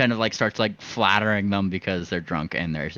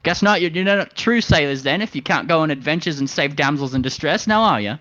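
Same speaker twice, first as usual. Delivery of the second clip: pitched up and taunting; high bird voice, mock disappointed; 6 semitones higher than usual. Goading the sailors, higher and mocking. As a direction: needle their pride with a sneer.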